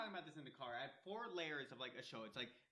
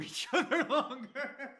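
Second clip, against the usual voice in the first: laughing; 9 semitones higher, 17 dB louder, much slower than usual. Real, not acted. Teasing banter with a laugh.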